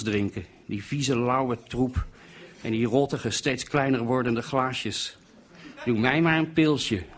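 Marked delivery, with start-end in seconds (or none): 5.8-7.0 higher-pitched sneering imitation; mocking mimicry. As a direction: parrot the order mockingly, pitched up. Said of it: "bauwde Harm na".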